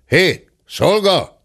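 slow call to the servant; drawn-out call. Acted. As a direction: summon the servant. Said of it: "kiabálta".

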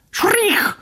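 very high, sharp bird call; kingfisher cry. A narrator's reading. Kingfisher calling back to the ducklings, high bird cry. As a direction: shriek it like a darting bird. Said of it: "kiáltotta a jégmadár".

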